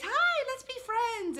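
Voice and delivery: high, slow chirpy hello; sing-song high mock greeting; bubbly greeting. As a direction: gush it like an eager new friend. Retelling friend's bubbly greeting.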